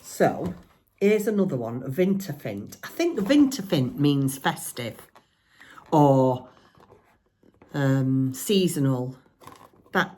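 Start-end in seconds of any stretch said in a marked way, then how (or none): none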